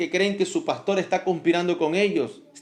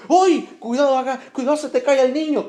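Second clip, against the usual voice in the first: high, loud startled warning; hurried, high-pitched imitation of panic; mock alarm; 8 semitones higher, 4 dB louder than usual. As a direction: cry out as if the baby's falling. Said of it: "Así se te cae y dice".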